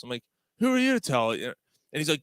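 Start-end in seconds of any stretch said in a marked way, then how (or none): 0.6-1.3 mock indignant challenge. Retold phone argument, challenging.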